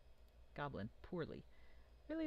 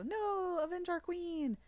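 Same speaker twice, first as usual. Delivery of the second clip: very high, loud goblin wail; goblins crying out; 6 dB louder, slower than usual. Acted. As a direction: shriek it like outraged goblins. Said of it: "and they go".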